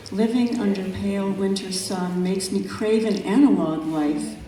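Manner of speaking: measured, unhurried poetry reading; calm, reflective recital of a nature poem. Acted. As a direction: let each image breathe in its pauses. Reads her second poem.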